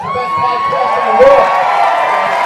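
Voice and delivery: stretched, booming crowd hype; hype announcer shout. Acted. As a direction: sell it like a ring announcer. Hyping the show to crowd.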